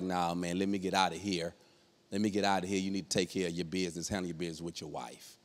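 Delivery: low, quiet imitation of a backing-out friend; uneasy low aside. Friend bowing out of a marital fight, low and quiet. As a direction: keep it down and excuse yourself quickly.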